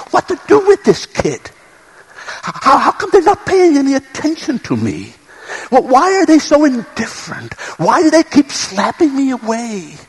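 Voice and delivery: put-on a parent wringing his hands over a stubborn kid; exasperated parent. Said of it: "wringing his hands like a parent, just at his or her wit's end".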